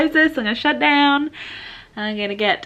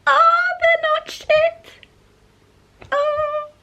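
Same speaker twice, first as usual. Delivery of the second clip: very high, slow tearful squeak; real relieved crying into a laugh; emotional, happy tears; much slower than usual. Real, not acted. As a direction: cry with relief, voice shooting right up. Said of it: "Why am I getting emotional".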